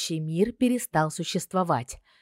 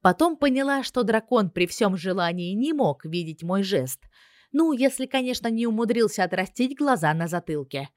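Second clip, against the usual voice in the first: ordinary delivery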